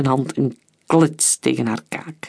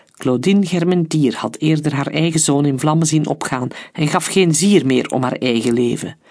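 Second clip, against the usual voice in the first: plain reading voice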